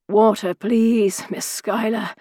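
slow, weak croak. A narrator's reading. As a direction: croak it out, parched. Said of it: "croaked a British regular".

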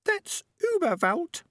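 tiny, very high, clear squeak; high-pitched, precise small-animal voice; small squeaky voice. A narrator's reading. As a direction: speak as a little squeaky creature correcting someone. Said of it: "squeaky but very clear voice".

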